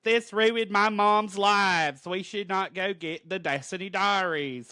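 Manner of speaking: Rogue in a hammed-up Southern drawl; Southern accent. Podcaster voicing Rogue yelling at Storm, hammy Southern accent. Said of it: "is that your Louisiana accent".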